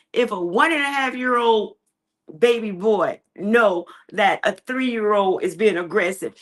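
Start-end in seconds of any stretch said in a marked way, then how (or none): none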